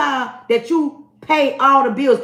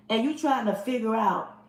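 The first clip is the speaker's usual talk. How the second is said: ordinary talk, nothing marked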